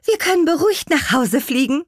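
high, happy-to-be-done exclamation; relieved call. A narrator's reading. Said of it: "rief Petronella erleichtert".

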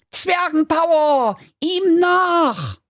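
very high squeaky rallying call; dwarf battle cry. Acted. Dwarfs launch the chase, high squeaky battle cry. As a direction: cheer the chase on like tiny heroes.

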